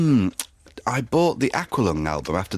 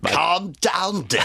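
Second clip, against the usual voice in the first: higher put-on voice of a bossy old director; Michael Winner; 8 semitones higher, much slower than usual. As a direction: purr it like a patronising film director. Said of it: "a Michael Winner impression".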